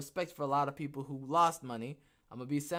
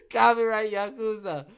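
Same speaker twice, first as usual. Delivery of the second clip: loud punchline; 7 semitones higher, 12 dB louder than usual. Real, not acted. Joking label, louder.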